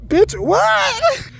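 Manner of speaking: sudden high, loud "Bitch, what?"; incredulous retort. Retold or imitated. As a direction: snap back in disbelief, high and loud. Retorting to the boss in her head.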